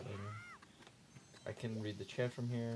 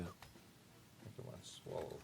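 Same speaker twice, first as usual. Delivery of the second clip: softer, slow, unvoiced whisper; 3 semitones lower, 10 dB quieter, much slower than usual. Real, not acted. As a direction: breathe it as a private aside. Unvoiced aside.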